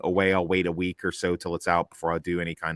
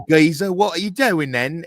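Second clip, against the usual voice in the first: higher, hammed-up London geezer voice; mock London accent; 7 semitones higher, 6 dB louder than usual. One character mocking another's accent in a London voice. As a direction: put on a cheeky London accent to tease. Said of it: "mocks his accent".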